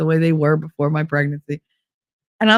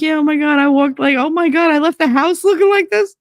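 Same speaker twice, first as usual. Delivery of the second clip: excited; 8 semitones higher than usual. Retold or imitated. Telling Jill about the mirror, high excited gush. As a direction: gush it out to friends, pitch up. Retelling her horror to friends.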